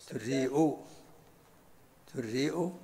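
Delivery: دريء as durri'u, glottal stop then u; u and hamza. Shown as a demonstration. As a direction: round the first vowel of دريء and add 'u at the end. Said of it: "بضم الدال والهمزة". (دريء بضم الدال والهمزة)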